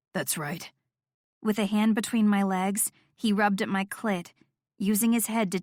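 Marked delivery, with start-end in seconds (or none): 0.1-0.7 very low and quiet; a hushed, low approval; low soft approval. Acted. Noah very low.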